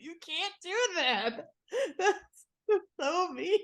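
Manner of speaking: high, squealing laugh-protest. Real, not acted. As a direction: beg him to stop through laughter. Laughing protest at prank idea.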